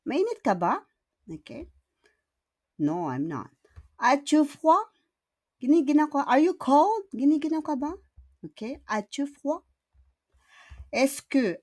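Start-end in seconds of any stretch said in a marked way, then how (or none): 4.0-7.9 high pitch rising on every question end; rising question tune. Shown as a demonstration. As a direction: lift each question up at the end. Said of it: "we need to do the intonation". (pataas ang boses sa dulo)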